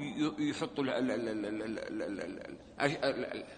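ordinary talk, nothing marked

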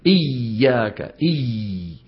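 "إياك" with the ya pressed hard and shaking, slow; over-pressed, trembling ya in "إياك". Shown as a demonstration. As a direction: squeeze the ya of "إياك" till it quivers. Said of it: "بيضغط عليها بحيث بصير الصوت يرتجف".

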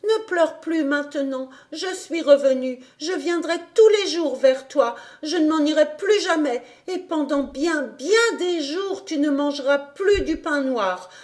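put-on a girl promising never to leave; little Heidi, comforting.

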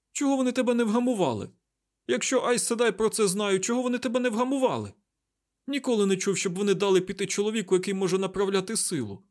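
high, loud, rapid disbelief; frantic questioning. A narrator's reading. Mat demands why Rand was not gentled, frantic. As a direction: rattle it out in high agitation.

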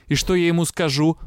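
usual speaking voice

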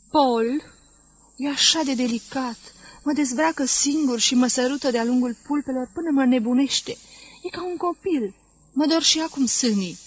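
ordinary delivery, nothing marked

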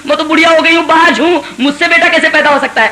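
high, loud cry of disbelief; astonished wife. Acted. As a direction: she can't believe it at her age. Old wife astonished at son news.

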